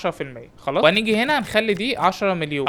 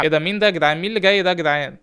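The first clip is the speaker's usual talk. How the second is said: ordinary delivery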